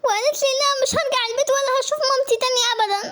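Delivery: put-on high-pitched, crying child's wail; tearful plea. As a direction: cry out to mama for rescue. Child runs crying to her mother. (استغاثة باكية)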